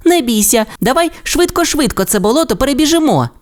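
high, fast, reckless little-dino urging; eager Timmi. A narrator's reading. Timmi urges Rasmus across the swamp, high and fast. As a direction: rush his friend into it.

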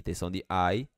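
usual speaking voice

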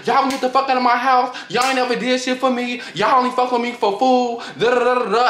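high-pitched imitation of a bawling rant; mock tearful outburst. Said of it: "bawling her tears out".

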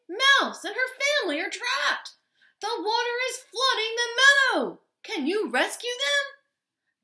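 high, loud urgent plea; yelling. A narrator's reading. Fox begs rescue for mice, loud high yell. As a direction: call out the alarm loudly. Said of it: "yelled Fox".

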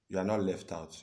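normal talking voice

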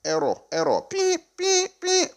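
high beeping computer alarm voice; glitchy machine calling out errors; glitching robot. Pretend. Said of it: "Computer has detected error".

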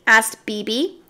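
plain reading voice

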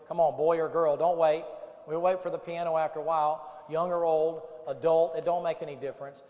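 loud, fervent revival preaching; altar call preaching. Acted. Preacher urging the campers to the altar, loud and fervent. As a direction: preach it full force, pleading with them.

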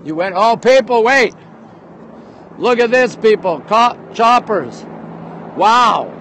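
ordinary talk

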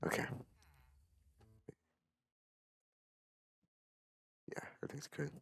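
whispering so the parents sleep; hushed late-night whisper; quiet at night. Real, not acted. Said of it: "I'm gonna be whispering the entire time".

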